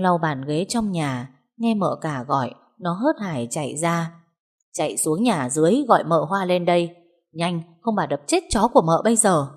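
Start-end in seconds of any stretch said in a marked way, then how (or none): none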